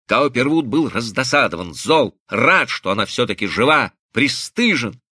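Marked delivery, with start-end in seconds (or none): none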